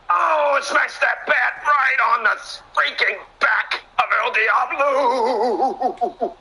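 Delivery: high, drawn-out commentator holler; excited big-hit call. Real, not acted. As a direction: stretch the name into a long, high whoop.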